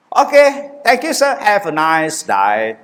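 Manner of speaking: mock Aussie accent, drawn-out ending; Australian officer. Mimicking the airport officer's goodbye in an Australian accent. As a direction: ham up the Aussie farewell. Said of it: "Bahasa dia".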